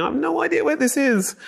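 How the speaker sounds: high-pitched flustered thought; panicked inner voice. Pretend. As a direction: squeak it out in panic. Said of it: "you're thinking".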